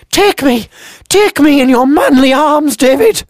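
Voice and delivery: put-on very high, quavery old-lady cry; hammed-up granny swooning. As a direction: pretend friend crying out amorously, granny voice. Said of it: "She sounds like a granny".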